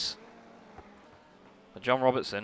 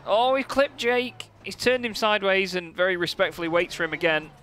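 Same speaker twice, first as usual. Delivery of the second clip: high, loud burst at the contact; excited; 7 semitones higher, 4 dB louder than usual. Real, not acted. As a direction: cry out as one car clips another. Contact between cars.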